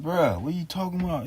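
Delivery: high, incredulous bruh; disbelief. Retold or imitated. Answering the tow guy's warning, high incredulous. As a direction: push it up in disbelief.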